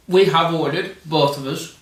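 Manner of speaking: ordinary talk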